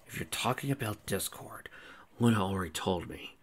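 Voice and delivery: soft whisper. A narrator's reading. Sable tells Celestia that Luna told him, whispered. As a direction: whisper it without voice. Sable's reply in intimate dialogue.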